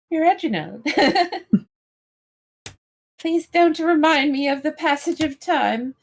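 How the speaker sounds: imperious plummy grande dame. Pretend. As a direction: swoon at the butler grandly. Grand lady's mock distress.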